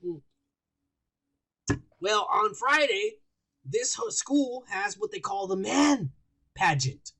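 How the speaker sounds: usual speaking voice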